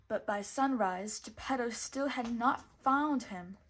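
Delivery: plain reading voice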